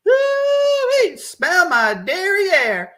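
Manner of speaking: very high, loud, slow hammed-up drawl; joking deputy. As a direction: ham it up about the stink. Deputy jokes about stench.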